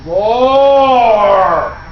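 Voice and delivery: loud, high, drawn-out bellow, word by word; greedy clay boy demanding food. Acted. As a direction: bellow each word long and hungry. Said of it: "and he said".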